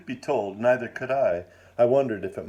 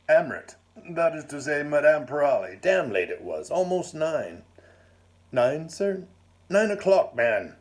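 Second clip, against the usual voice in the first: put-on blustering old lord; 5 semitones higher than usual. A narrator's reading. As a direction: boom it out like a jolly old lord. Hearty lord voiced in story.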